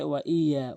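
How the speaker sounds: "ওয়া ইয়া" short, no doubling; mistaken single Y. Shown as a demonstration. Teacher quotes the mistake, "ইয়া" undoubled. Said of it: "এখানে বলে".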